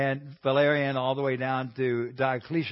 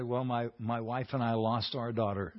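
softer, low and calm; 4 semitones lower, 9 dB quieter than usual. Retold or imitated. Friend calmly answering the angry mother, soft and steady. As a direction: answer gently, no heat. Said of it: "he just very calmly said".

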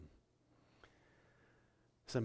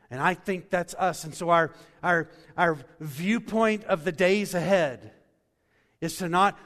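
preached psalm quote; 8 semitones higher, 16 dB louder than usual. Acted. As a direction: stretch every word of the psalm, much louder. Preaching at full force.

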